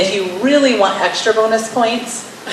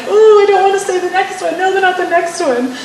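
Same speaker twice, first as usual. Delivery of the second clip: much higher, squealing reluctance in a put-on voice; mock squeamish squeal; 11 semitones higher than usual. Speaker dreading her own list, in a high mock squeal. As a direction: squeal like you can't bear to read the next one. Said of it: "I'm like".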